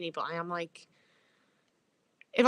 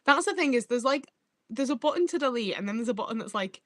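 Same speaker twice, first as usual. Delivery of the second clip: ordinary talk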